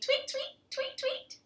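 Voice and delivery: very high, bright-pitched bird tweets; squeaky birdsong imitation; tweeting birds. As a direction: chirp in little pairs.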